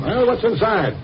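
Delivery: can't wait to know what's inside; eager impatience. Acted. Partner pressing the old man to look, eager.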